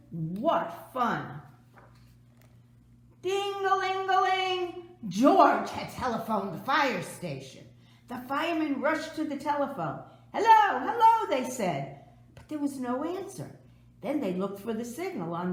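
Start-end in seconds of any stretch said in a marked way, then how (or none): 3.2-5.0 high, slow sing-song ringing; imitated phone ring. A narrator's reading. Narrator imitating the fire station telephone ringing. 10.3-11.3 urgent hello. A narrator's reading. Firemen answering the phone.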